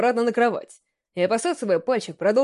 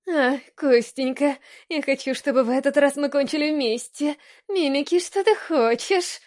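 cute little voice; 4 semitones higher than usual. Acted. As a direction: coo it like a cute fox girl. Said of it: "Милым голоском сказала Ками".

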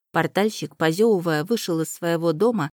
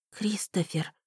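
softer, barely voiced name; quiet whisper; 3 semitones higher, 8 dB quieter, slower than usual. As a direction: breathe his name in disbelief. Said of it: "тихо прошептала Эллен".

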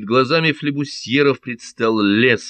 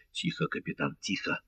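hushed warning; 11 dB quieter than usual. A narrator's reading. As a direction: hush the captain under your breath. Urging the captain to hush.

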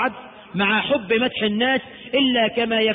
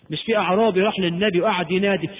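ordinary talk

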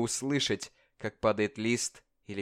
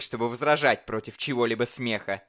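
ordinary delivery, nothing marked

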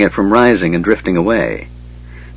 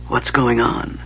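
faint, drained voice; barely enough breath to ask; weak and quiet; 2 semitones higher, 7 dB quieter, slower than usual. Acted. Weak mage just revived.

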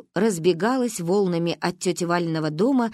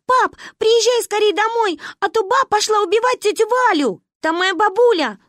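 high, loud urgent pleading; panicked child yelling; 13 semitones higher, 6 dB louder than usual. A narrator's reading. Girls call dad for help, high panicked yelling. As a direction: shout down the phone for dad to hurry. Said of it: "проорала Маня в трубку".

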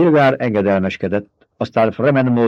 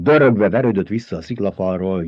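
usual speaking voice

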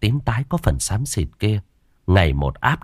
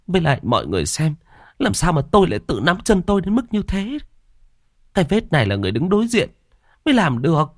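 put-on high-pitched agitated old woman; insistent old woman; 9 semitones higher than usual. A narrator's reading. Mrs Năm insists to villagers she saw ghost, high and agitated. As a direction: plead to be believed, rattled.

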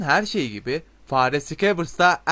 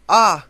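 sudden loud, higher "Ah!"; startled cry of pain; 5 semitones higher, 11 dB louder than usual. A narrator's reading. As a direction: yelp as something hits your head. Sudden blow to the head.

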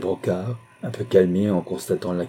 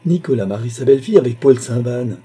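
loud, raised-pitch announcement; vibrant agitation; 4 semitones higher, 7 dB louder than usual. A narrator's reading. Numa announcing the rival's wedding to his family, agitated. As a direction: burst out with the shocking news. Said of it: "Son verbe à la fois tremblé et vibrant".

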